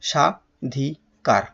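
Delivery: সা, ধি, কার with a pause after each; syllable by syllable. Shown as a demonstration. Teacher summing up the word's parts, সা, ধি, কার said separately. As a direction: set out সা, ধি, কার as three pieces. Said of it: "তাহলে কি হলো?".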